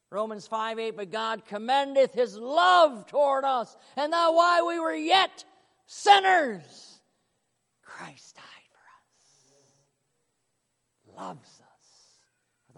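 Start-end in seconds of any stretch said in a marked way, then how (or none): 1.0-7.0 rising, louder proclamation; preaching loudly. Acted. Preaching scripture at full force. 7.8-12.8 sudden whisper after the shout; hushed whisper. Acted.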